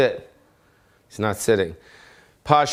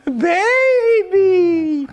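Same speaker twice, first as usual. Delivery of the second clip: long high doggy squeal; 5 dB louder than usual. Real, not acted. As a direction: squeal at the puppy. Squeals at dog.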